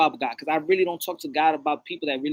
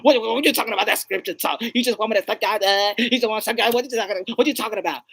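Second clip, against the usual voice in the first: raised, argumentative comic retort; mock indignation; 4 semitones higher, 3 dB louder, faster than usual. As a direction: keep up the offended quarrel over scripture. Acted woman keeps quarrelling.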